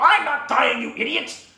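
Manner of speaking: high, loud scolding cry; hammed-up exasperated master; acted irritated retort. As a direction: snap back at the panicking pupil. Master snaps back at Po.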